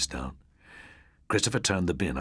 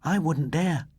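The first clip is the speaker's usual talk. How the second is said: put-on girl voice; 12 semitones higher, 3 dB louder, slower than usual.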